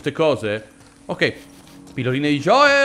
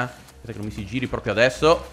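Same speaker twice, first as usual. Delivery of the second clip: ordinary talk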